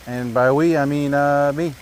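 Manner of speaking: ordinary talk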